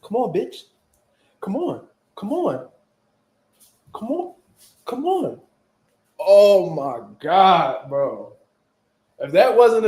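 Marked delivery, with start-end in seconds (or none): 0.0-5.4 soft, high-pitched goading with pauses between; imitated street-fight challenge; mock taunt. Acted taunt from a fighting stance. 6.2-8.3 loud, long-stretched exclamation dropping at the end; slow, incredulous groan of words; drawn-out exasperated amusement. Real, not acted. Cracking up at his memory.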